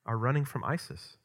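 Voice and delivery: ordinary talk, nothing marked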